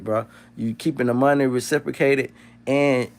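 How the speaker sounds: ordinary delivery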